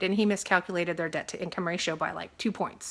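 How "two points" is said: ordinary talk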